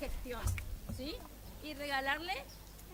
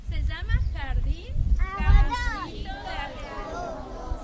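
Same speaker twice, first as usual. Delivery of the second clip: slow announcing; 3 semitones higher, 11 dB louder, slower than usual. Real, not acted. Announcing the name to crowd.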